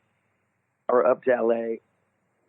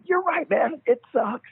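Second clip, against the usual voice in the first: nervous agreeing; 13 semitones higher than usual. Retold or imitated. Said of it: "I'm like".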